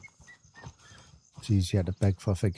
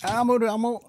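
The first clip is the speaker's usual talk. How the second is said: much higher, louder yelp of alarm; panicked high cry; 6 dB louder than usual. Real, not acted. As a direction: shriek it as you flee the fight. Under fire in a game.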